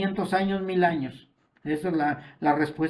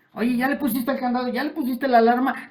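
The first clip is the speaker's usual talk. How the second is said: higher, fast nagging questions; fussy homeowner; 6 semitones higher, faster than usual. Acted. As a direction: rattle off worried checks like a fussy spouse. Pastor voices an anxious homeowner.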